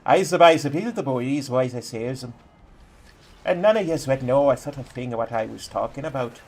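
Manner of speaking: a moderate Newfoundland accent, rising on the last question; Newfie accent. Shown as a demonstration. Streamer showing chat what a Newfoundland accent sounds like, in a Newfie accent. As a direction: lay on the Newfoundland lilt and end with a teasing rise. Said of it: "If I really wanted to speak like a Newfie".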